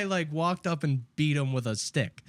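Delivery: normal talking voice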